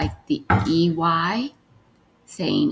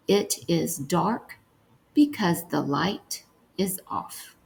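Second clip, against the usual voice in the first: slow, softer dictation; 4 dB quieter than usual. Shown as a demonstration. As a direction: dictate it slowly and quietly. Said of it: "The last one we're gonna write is".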